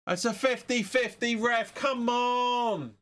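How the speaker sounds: high, drawn-out "come on" at the referee; exasperated protest. Real, not acted.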